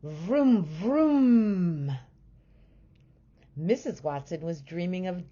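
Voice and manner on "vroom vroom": louder, higher vroom vroom like a racing car; dreamy car noises. A narrator's reading. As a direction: rev the engine in a dream. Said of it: "said Mister Watson in his sleep".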